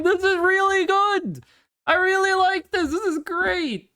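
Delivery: high-pitched real delight; excited praise. Real, not acted. Streamer praising fan art, high excited gushing. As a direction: gush in a high, thrilled voice.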